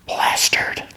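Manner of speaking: dramatic whispered reveal. Acted. Ben telling Janet what he got, whispered. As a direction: drop to a whisper on the last word. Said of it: "why you whisper that".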